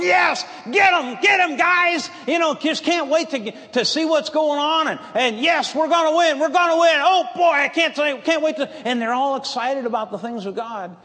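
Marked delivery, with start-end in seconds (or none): 0.0-2.1 high, loud cheering for the fight; excited battle shout. Retold or imitated. David shouting for the battle, excited shout. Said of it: "he's shouting for the battle". 5.4-8.7 high, loud eager cheer; bursting with eagerness to win; excited cheering. Retold or imitated. David cheering before the battle, eager shout. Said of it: "he's shouting for the battle".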